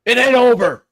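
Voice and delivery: high, loud defiant yell; defiant shout. Acted. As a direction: spit it back in defiance. Jerry defiant at gunpoint.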